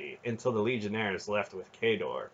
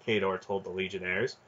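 plain reading voice

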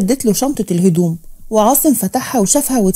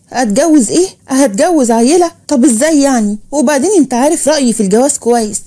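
plain reading voice

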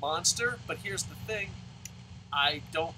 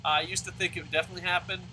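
ordinary delivery

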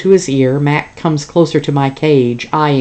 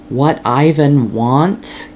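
slow, drawn-out doubtful echo; slow repeat; much slower than usual. Acted. Father echoing his daughter in disbelief, slowly. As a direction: stretch each word, not buying it. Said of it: "he repeats slowly".

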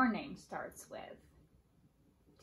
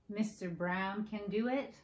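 usual speaking voice

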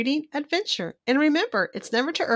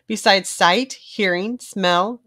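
ordinary delivery, nothing marked

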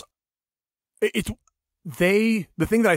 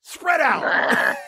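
mock yell at the twins; exasperated dad; 7 semitones higher, much slower than usual. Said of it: "And I'm like".

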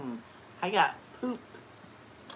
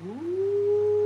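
excited ooh; 7 dB louder than usual. Real, not acted. Excited squeal on camera.

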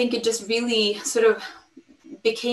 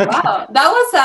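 laughing; 6 semitones higher, 7 dB louder than usual. Real, not acted. Laughing at Swedish surprise.

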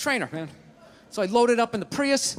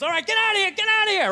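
trainer shouting; 12 semitones higher, 4 dB louder, faster than usual. Retold or imitated. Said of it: "he just grabs and goes".

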